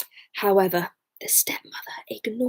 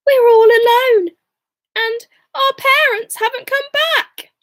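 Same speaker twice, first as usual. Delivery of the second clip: put-on high, loud panicky child voice; frightened child; 12 semitones higher, 6 dB louder than usual. Gretel to Hansel, alone in the dark woods, frightened and high. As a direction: cry out in alarm at being left. Abandoned children, parents gone.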